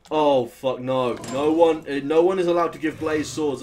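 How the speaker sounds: loud, exasperated protest; loud dismayed outburst. Real, not acted. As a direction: shout it down in dismay.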